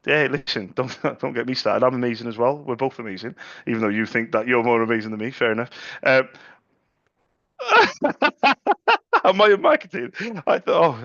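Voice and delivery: normal talking voice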